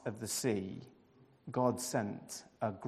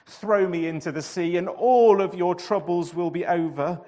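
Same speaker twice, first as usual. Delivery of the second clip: groaning confession; 6 semitones higher, 9 dB louder than usual. A narrator's reading. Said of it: "Jonah groaned".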